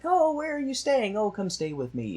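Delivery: gushing friends. Retold or imitated.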